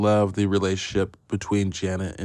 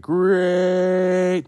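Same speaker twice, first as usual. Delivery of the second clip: drawn-out high voice; 11 semitones higher, 4 dB louder than usual. Pretend. Drawn-out joke on many greats.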